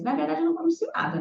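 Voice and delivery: normal talking voice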